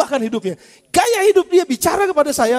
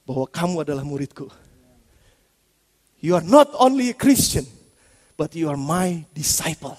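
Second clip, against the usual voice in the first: tender Jesus; 10 semitones lower, 5 dB quieter than usual. Pretend. Jesus gently telling disciples to love, softly. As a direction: speak like a loving teacher, hushed. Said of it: "bahasa imajinasi saya kalau Yesus ngomong".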